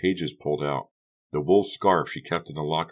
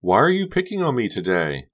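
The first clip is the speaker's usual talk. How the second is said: high, loud, wounded demand; tearful demand; 7 semitones higher, 5 dB louder than usual. A narrator's reading. Honey to a furious Becca, tearful demand. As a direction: demand it on the edge of tears. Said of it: "Honey demanded, tears forming".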